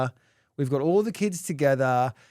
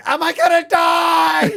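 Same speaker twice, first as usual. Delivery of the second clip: slow, high, loud imitated wail; hysterical child; 14 semitones higher, 10 dB louder, slower than usual. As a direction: wail it as a panicking daughter. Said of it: "Marley's like, going".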